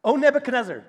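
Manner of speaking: a loud, stately "O Nebuchadnezzar". Shown as a demonstration. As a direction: declare "O Nebuchadnezzar" boldly to the king. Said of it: "Oh, that didn't work".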